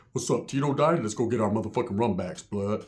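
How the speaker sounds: mock-serious low tough guy. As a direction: drop the voice low and get serious. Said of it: "but then when he gets serious".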